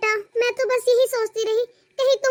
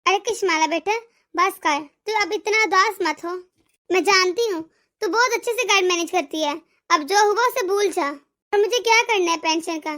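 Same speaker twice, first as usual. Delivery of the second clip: tender comfort; 5 semitones lower than usual. Acted. Mother comforts her daughter-in-law. (बहू को दिलासा देती माँ, बहुत नीची, धीमी और कोमल आवाज़)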